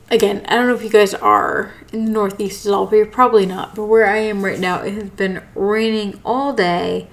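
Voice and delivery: ordinary delivery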